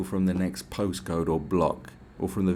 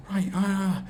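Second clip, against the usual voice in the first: high-pitched, overdramatic retold alarm; over the top; 11 semitones higher than usual. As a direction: imitate a drunk friend overreacting wildly. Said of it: "he was being really O T T".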